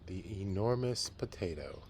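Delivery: lively read-aloud storytelling voice; story narration. A narrator's reading. Teacher reading a picture book to the class, storytelling.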